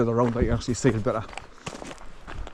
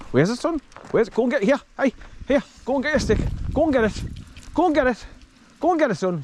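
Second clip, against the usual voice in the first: urging the dog; 11 semitones higher than usual. Real, not acted.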